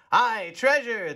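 crew shout. A narrator's reading. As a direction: bellow it like a rowdy crew. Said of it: "the others shouted".

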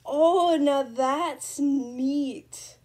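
loud, slow awed delight; excited. Real, not acted. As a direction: linger on it, amazed. Continued delight.

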